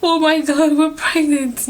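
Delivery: high, loud, thrilled "oh my God"; overjoyed exclaim. Retold or imitated. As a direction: burst out with joy. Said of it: "I was just like".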